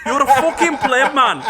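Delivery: high, loud taunt breaking into laughs; half-laughing hammed-up insult; laughing mock jeer. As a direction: yell it while cracking up.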